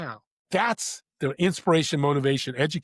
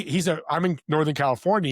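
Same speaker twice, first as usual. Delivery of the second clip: ordinary delivery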